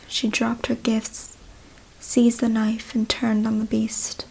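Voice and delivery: ordinary delivery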